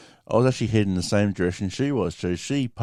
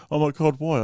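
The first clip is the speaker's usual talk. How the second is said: high, anxious imitation; retold flustered squeak; mock nervous; 7 semitones higher than usual. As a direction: imitate a scared driver asking warily. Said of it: "She was shitting herself".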